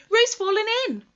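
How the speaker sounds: high, loud alarmed shout; cry of alarm. Acted. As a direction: yell it out in sudden panic. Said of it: "cried Rabbit".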